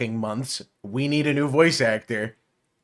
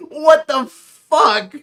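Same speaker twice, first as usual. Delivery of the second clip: high, slow, loud disbelief; shocked disbelief; 14 semitones higher, 9 dB louder, much slower than usual. Real, not acted. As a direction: drag it out in shock. Shocked reaction to cameo.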